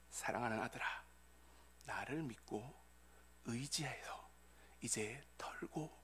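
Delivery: hushed, low, tender near-whisper; hidden voice of God. Acted. God comforting a beloved son, tender hushed voice. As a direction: console very softly, with long pauses. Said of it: "숨겨진 하나님의 음성을 들었습니다".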